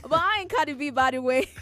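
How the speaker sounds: loud laughing protest. Real, not acted. Playful denial, laughing.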